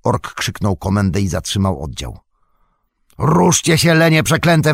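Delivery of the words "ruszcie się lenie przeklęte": raised, forceful abusive shout; orc yell. A narrator's reading. Orc driver cursing two lagging soldiers, yelling. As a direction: drive the slackers on with a loud high yell. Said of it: "wrzasnął".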